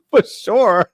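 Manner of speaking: high mimicry, "sure" stretched out slowly; odd delivery imitated. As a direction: laugh while mimicking a long, drawn-out "sure". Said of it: "That's what it was".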